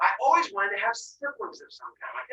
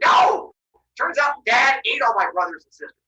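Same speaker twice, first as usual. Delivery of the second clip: loud, raised-pitch protest; acted outrage; 5 semitones higher, 7 dB louder than usual. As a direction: burst out with mock indignation. Zeus outraged at dad.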